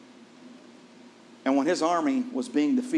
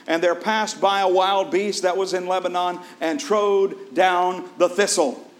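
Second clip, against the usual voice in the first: scripture reading; 3 semitones higher, 5 dB louder than usual. Acted. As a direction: proclaim the old parable from the pulpit. Reads the king's parable aloud.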